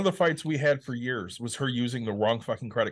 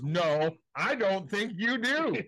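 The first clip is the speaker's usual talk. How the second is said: slow, higher, patronizing judge; stern judge; 7 semitones higher, much slower than usual. Retold or imitated. Retelling the judge scolding Vinny, slow and patronizing. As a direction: spell it out like to a toddler. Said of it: "And he goes".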